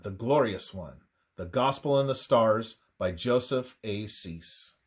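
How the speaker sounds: ordinary talk, nothing marked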